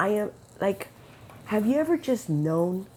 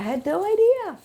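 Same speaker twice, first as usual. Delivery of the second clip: high and loud in wonder; amazed exclamation; 13 semitones higher, 5 dB louder than usual. Real, not acted. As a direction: burst out in astonished disbelief. Amazed exclamation about God's plan.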